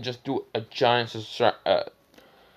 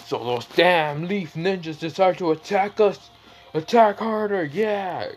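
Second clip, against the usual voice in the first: raised, higher angry leader voice; an angry village chief ordering the counterattack; angry Raikage; 6 semitones higher than usual. Retold or imitated. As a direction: bark orders at your troops, pitched up high. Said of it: "the Raikage is angry".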